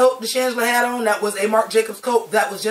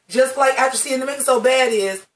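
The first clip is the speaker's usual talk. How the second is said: ordinary talk, nothing marked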